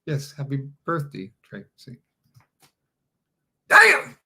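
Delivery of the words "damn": high, loud burst of "damn!"; catchphrase damn on request. Shown as a demonstration. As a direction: belt out the catchphrase, far above your talking pitch. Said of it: "Can you get a damn?".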